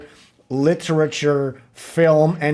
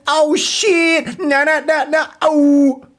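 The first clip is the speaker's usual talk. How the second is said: mock rapper; 9 semitones higher than usual. Mocking the streamer's rap.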